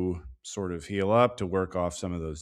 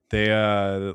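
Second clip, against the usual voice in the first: ordinary talk, nothing marked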